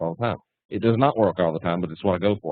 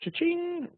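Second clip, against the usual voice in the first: high, softer imitation of a till; cash-register cha-ching; 7 dB quieter than usual. Mimicking traders after another gain, high soft cha-ching. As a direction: let the register ring, high and light. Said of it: "ring the register".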